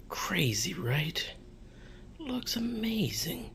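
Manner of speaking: soft whisper rising high; awed whisper. Real, not acted. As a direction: whisper it in amazement. Showing off new setup.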